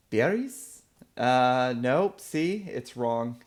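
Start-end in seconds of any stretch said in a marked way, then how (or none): 0.1-0.8 Berries? tried high and tentative as a guessed name; unsure name attempt. Real, not acted. Reading a viewer's name aloud, hesitant guess. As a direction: sound out Berries? like a shaky first try at a stranger's name. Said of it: "gosh, I'm gonna ruin this".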